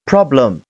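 "problem" as one connected word, falling at the end; joined-up word. Shown as a demonstration. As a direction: say "problem" whole, without breaks. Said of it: "连起来是".

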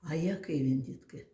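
ordinary talk

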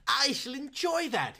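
high, slow, thrilled; excited. Acted. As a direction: savour it with excitement. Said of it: "he cried, eyes flashed with excitement".

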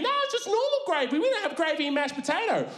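high, meek explaining voice; flustered server. Retold or imitated. KFC girl to dad, high flustered explanation. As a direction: nervously defend the gravy. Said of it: "server explains".

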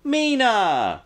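high, drawn-out MEE-naaa for Mina; imitated greeting. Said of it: "the one character who pronounced it properly".

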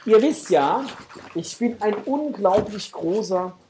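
normal talking voice

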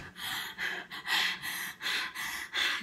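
long, airless breath out, mimicking her spent friend; imitated exhausted sigh. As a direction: act out someone too winded to talk. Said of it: "she was like".

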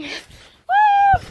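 high loud whoop mid-jump; excited whoop. Real, not acted. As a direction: cheer on the jump. Back slam on trampoline.

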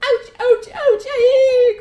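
high, loud cartoon yelps; hammed-up shrieks of pain; crying out in pain. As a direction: yelp as if whacked, ending in a wail. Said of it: "cried Mister Alligator".

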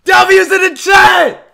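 high, drawn-out victory scream; ecstatic screaming. Real, not acted. Streamer calls chat to celebrate, ecstatic screaming. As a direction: scream it long and full force.

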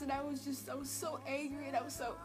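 ordinary talk, nothing marked

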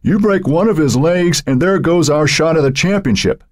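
high, heated warning; baleful warning. A narrator's reading. Eric warning a teammate off hurting Matt, baleful. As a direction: warn him off, pitched high. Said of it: "Eric eyed Ryerson balefully".

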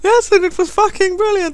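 very high falsetto retort; mock child answering a parent; little girl voice. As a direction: squeak back like a cheeky daughter. Child actress answers parent back.

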